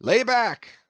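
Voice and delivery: boat-header urging his crew; shouted order. A narrator's reading. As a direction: drive the crew at the oars. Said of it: "addressing his crew".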